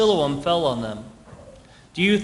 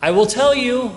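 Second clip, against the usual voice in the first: emphatic; 7 semitones higher, 6 dB louder than usual. Real, not acted. Preacher builds to emphatic point.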